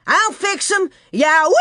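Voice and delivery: whooping high battle yell ending in a screech; wild shout. Acted. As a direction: let out a wildcat war whoop. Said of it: "catamount screech".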